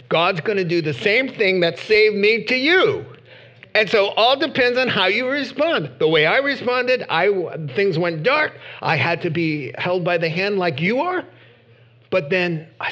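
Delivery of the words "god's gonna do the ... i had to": high, loud sermon at full force; impassioned preaching. Real, not acted. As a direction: press the plea urgently, pitched high and loud.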